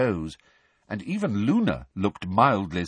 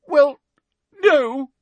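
high, loud, choked denial; tearful halting wail; sobbed; 8 dB louder than usual. A narrator's reading. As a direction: sob out each word. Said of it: "sobbed Hermione".

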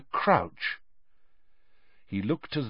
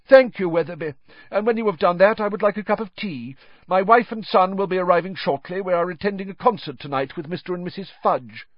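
higher, brisk official chatter; lightly voiced deranged official; fluent rambling; 9 semitones higher, 4 dB louder, faster than usual. A narrator's reading. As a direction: chat on as if in the office. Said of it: "talking fluently to a tree again".

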